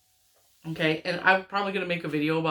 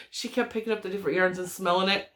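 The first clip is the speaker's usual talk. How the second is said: ordinary talk, nothing marked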